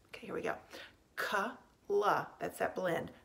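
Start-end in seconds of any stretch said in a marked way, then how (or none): 1.2-2.3 "K" and "la" as two separate sounds; sounding out slowly. Shown as a demonstration. Teacher helping pupils spell clap, sounding out the blend. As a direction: break the blend into "K", pause, "la". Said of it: "let's say it slowly".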